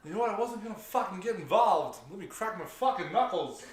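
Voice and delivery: a high, hammed-up gamer losing it; imitating a gamer about to snap; mock rage rant. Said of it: "in that accent".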